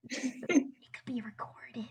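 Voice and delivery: slow hushed whisper; whispering on the phone. Acted. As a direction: breathe it out slowly, unvoiced. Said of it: "Tyler goes".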